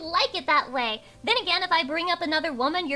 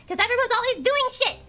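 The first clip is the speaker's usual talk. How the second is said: ranting exclaim; 10 semitones higher, 6 dB louder than usual. Real, not acted. Ranting about streamers.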